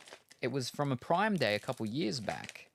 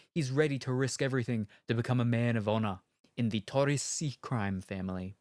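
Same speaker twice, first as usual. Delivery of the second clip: louder, weighty trailer narration; trailer voice read; 4 dB louder than usual. Acted. Reading the game box to chat, trailer voice.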